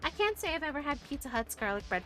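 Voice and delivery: normal talking voice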